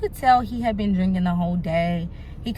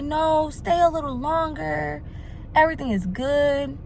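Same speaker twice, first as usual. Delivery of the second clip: coaxing; 6 semitones higher than usual. Retold or imitated. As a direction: imitate him coaxing, stretching the words. Drunk man coaxing her to stay.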